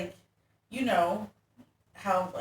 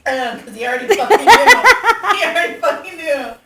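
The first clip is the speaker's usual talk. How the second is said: loud, high, laughing through the words; cracking up while talking; 9 semitones higher, 18 dB louder than usual. Real, not acted. Friend laughing loudly at how the dad already knew.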